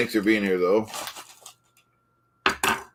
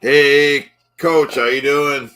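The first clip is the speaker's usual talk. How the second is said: louder, higher hail; hearty greeting; 4 semitones higher, 6 dB louder, slower than usual. Acted. Host hailing the coach, loud hearty greeting. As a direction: call out to an old pal.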